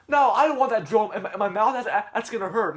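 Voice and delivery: put-on high, loud kid wail; imitated kid dreading the drill; protesting kid character. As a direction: plead in a mock-frightened high voice. Voicing Jeffy dreading the drill.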